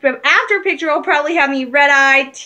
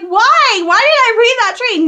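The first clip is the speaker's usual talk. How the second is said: louder, much higher panicky why; panicked outburst; 8 semitones higher, 6 dB louder than usual. Retold or imitated. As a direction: wail it like a fan who regrets reading a spoiler. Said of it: "And I'm like".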